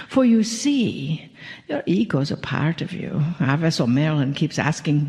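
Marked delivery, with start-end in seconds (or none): none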